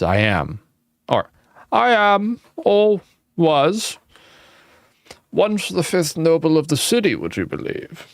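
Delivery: put-on high, wavering old ghost; reedy old man's lament. Voicing the old man.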